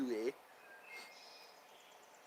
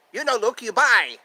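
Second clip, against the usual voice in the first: higher, louder cartoon accent; Laotian neighbor impression; 7 semitones higher, 6 dB louder than usual. Asking about the guy from Laos, in his cartoon voice. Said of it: "I do that guy from Laos".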